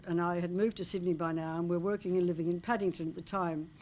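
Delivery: ordinary talk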